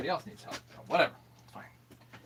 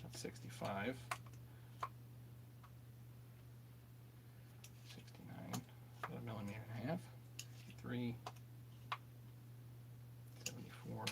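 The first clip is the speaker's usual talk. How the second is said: muttering quietly; 19 dB quieter than usual. Real, not acted. Muttering measurements to himself.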